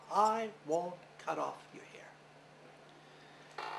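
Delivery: slow, whispered refusal to grandma. Acted. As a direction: breathe it out slowly, almost unvoiced. Maui refuses his grandmother.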